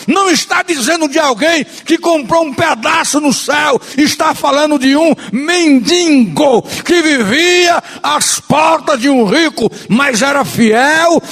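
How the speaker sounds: high, insistent pulpit cadence building by repetition; impassioned preaching. Real, not acted. Preacher exalting God who rewarded the faithful beggar, impassioned.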